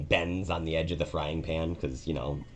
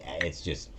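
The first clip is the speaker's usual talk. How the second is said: ordinary talk, nothing marked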